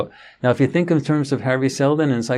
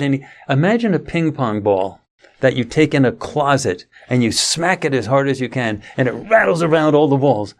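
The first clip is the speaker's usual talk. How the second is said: usual speaking voice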